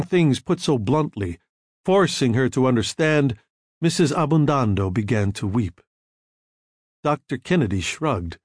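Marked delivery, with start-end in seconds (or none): none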